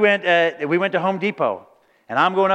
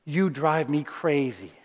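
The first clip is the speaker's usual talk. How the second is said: soft tease; 4 semitones lower, 5 dB quieter, slower than usual. Retold or imitated. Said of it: "She's like".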